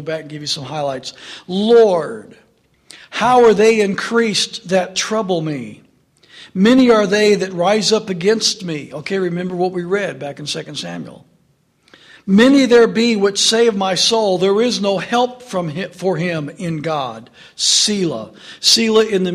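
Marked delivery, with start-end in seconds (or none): 1.5-8.9 louder, solemn psalm reading; scripture reading. Real, not acted. Preacher reads Psalm three aloud to church, solemn reading. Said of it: "So let's just read it". 12.3-18.3 louder, solemn psalm reading; scripture reading. Real, not acted. Preacher reads Psalm three aloud to church, solemn reading. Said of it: "So let's just read it".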